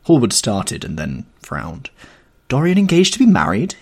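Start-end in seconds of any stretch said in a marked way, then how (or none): 2.5-3.8 much higher and louder, astonished; Basil crying out in shock at the news. Acted. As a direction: cry it out in disbelief. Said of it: "he cried".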